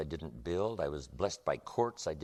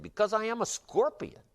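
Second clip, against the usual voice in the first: scorpion's answer; 3 dB louder than usual. Acted.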